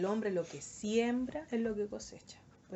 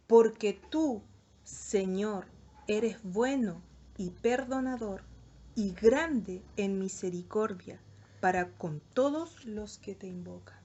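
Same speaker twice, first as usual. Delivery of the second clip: slow, louder, solemn reading of the psalm; scripture reading; 5 dB louder, slower than usual. Acted. Preacher reading the psalm aloud to the youth, solemn and slow. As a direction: proclaim the verse slowly and fully.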